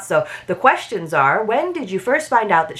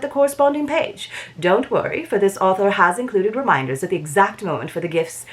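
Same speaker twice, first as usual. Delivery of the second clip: hammed-up Regency British accent, like a society gossip columnist; bad British accent; 2 semitones higher than usual. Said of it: "in my worst British accent".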